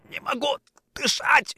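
high, strangled croak; choked wheeze. A narrator's reading. Orlov with hands on his throat, choked wheeze. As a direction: squeeze it out as if throttled. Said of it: "хрипел Орлов".

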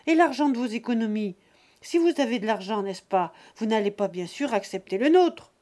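fast, loud pressing son; sly accusation. Acted. Son springing his trap on his father, fast and pushy. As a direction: rush in for the kill.

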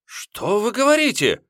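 очень высоко, фальшиво-испуганно; изобразить испуг напоказ; наигранное изумление. A narrator's reading. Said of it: "воскликнул семь копеек, нарочито". (вор притворно изумляется обвинению)

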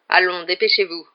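loud curt command; barked order. A narrator's reading. Snape hurries the pupils along with a loud bark. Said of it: "aboya Rogue".

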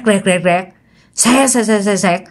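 crackling static noise made with the mouth; buzzing mock static sounds; imitated TV static. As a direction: hiss out the crackle of a TV losing signal. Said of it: "nghe cái tiếng rẹt".

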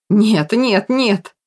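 shouting. A narrator's reading. Kirill denies the ghost before him, shouting in panic. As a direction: cry out in refusal. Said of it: "принялся кричать Кирилл".

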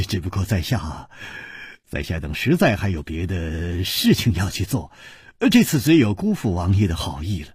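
low, halting, rueful refusal; wry apology. A narrator's reading. Hero turning down the king's kindness, wryly apologetic. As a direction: decline with a small rueful chuckle. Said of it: "苦笑着说".

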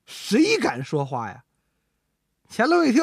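ordinary delivery, nothing marked

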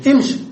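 امشي begun with i, "imshi"; kasra on the hamza. Shown as a demonstration. Teacher showing the command of masha, امشي begun with i. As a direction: say امشي as "imshi", short i first. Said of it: "بكسر الهمزة".

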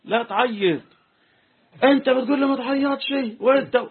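Jordanian man retorting. Retold or imitated. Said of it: "قال له".

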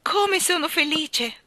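high, loud, stretched-out cry of joy; happy cry. A narrator's reading. Girl whirling round the room, happy cry. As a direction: cry out high and slow with happiness. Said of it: "gridò Jane".

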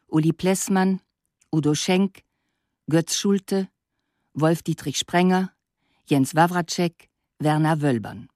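normal talking voice

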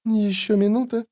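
high, held-in hiss; tense, high-pitched restrained impatience; hissed through a smile. A narrator's reading. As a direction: seethe through a sweet smile. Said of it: "прошипела Йеннифер сквозь мило улыбающиеся губы".

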